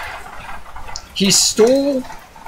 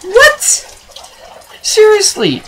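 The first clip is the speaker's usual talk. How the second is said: very high, incredulous outburst; baffled disbelief; 4 dB louder, slower than usual. Acted. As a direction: shoot your voice way up in comic disbelief. Baffled reaction to the one-liner.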